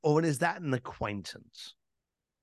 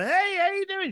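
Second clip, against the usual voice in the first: acted buddy greeting. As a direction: hail a casual buddy at an event. Acted hello to buddies at event.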